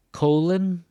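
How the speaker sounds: "colon" said KO-lon, first syllable high and loud; stress on first syllable. Shown as a demonstration. Teacher contrasting two words, stressing the start of "colon". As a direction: punch the front of "colon", let "lon" fall away. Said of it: "Where do you hear the stress".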